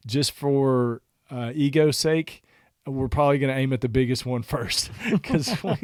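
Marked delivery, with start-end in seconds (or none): 5.1-5.7 higher-pitched, breaking up with laughter; laughing. Real, not acted. Hunter admitting they aim at the biggest pig, laughing. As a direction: laugh through the words. Amused at own admission.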